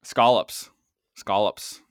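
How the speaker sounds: put-on scallops in a Boston accent. Host repeats scallops to tease a co-host, Boston accent. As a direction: say it Boston, punched out. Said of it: "are you doing it in, in your Boston accent".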